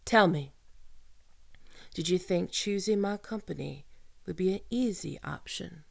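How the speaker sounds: ordinary talk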